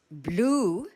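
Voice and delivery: normal talking voice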